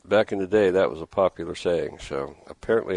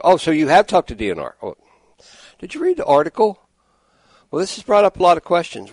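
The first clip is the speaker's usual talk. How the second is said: imitating her, pitched up and louder; mock caller voice; 9 semitones higher, 5 dB louder, faster than usual. Host mimics the woman who kept talking, huffy mock voice. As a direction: parrot the caller's huffy comebacks. Retelling a dismissive caller's replies.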